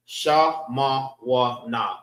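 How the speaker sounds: Sha, Ma, Wa, Na, one beat each; evenly separated Sha, Ma, Wa, Na; four syllables. Shown as a demonstration. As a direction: sound out Sha... Ma... Wa... Na. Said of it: "That's four syllables".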